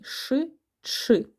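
Ш and ч said apart, a hushing sh then a short ch; sh, then ch, slowly and clearly; splitting sh and ch. Shown as a demonstration. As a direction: break щ into its two sounds. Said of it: "Чуємо два звуки приголосні".

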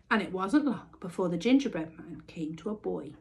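ordinary delivery

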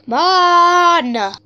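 loud, high exasperated cry; frustrated shout. Real, not acted. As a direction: yell at the screen. Frustrated at game.

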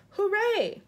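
high, loud hooray; ringing storybook cheer; cheering cry. A narrator's reading. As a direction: cheer it out like a delighted crowd. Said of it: "about a dozen people cried".

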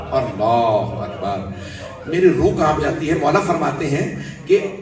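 loud, ringing sermon climax; full-force preaching. Acted. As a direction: preach it out to the whole hall.

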